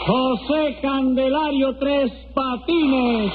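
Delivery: high, drawn-out roll call; singsong high summons; court crier calling names. Acted. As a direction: call the name out slowly across the courtroom. Court crier calls the parties.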